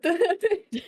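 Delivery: embarrassed giggle through words; laughing. Real, not acted. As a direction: laugh while owning the slip. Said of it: "slipped into Princess's voice".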